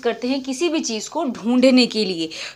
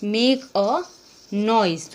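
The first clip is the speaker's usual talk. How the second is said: slow dictation; much slower than usual. Shown as a demonstration. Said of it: "क्या कह सकते हैं?".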